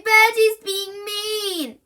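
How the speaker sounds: slow, high sing-song whine; whiny wail. A narrator's reading. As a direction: drag out the complaint. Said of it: "wailed Susie".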